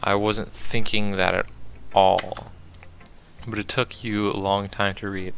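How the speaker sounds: ordinary delivery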